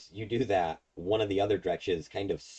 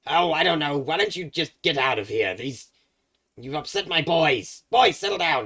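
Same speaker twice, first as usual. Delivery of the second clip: much higher, loud hag shout across the room; hag yelling; 10 semitones higher, 10 dB louder than usual. Acted. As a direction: scold the brawling dretches from afar. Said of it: "And she goes".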